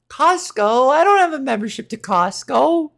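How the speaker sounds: normal talking voice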